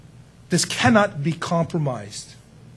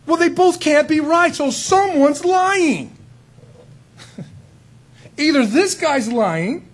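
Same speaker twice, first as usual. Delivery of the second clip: much higher, louder sermon climax; preaching at full force; 10 semitones higher, 5 dB louder than usual. Acted. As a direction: press the point home to the congregation.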